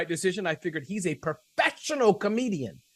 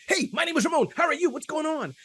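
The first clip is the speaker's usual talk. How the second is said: brash American hello; 10 semitones higher than usual. Retold or imitated. Retelling meeting the young Japanese lady, brash American hello. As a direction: bounce in with a big casual hi. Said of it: "American style".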